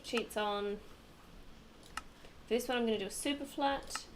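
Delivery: normal talking voice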